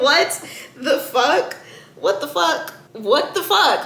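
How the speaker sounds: high-pitched, laughing disbelief; retold outraged astonishment; incredulous disbelief. Reacting to insulting text, incredulous.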